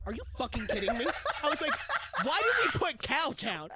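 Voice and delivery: loud, high, laughing disbelief; incredulous laughing. Retold or imitated. Asking group about wrong answer, loud laughing disbelief. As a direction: demand it through laughter. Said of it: "and I'm like".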